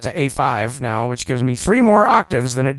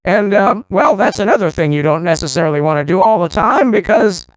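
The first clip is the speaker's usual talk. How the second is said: high vocoder voice; 6 semitones higher, 4 dB louder than usual. Shown as a demonstration. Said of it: "the tracking is now less accurate".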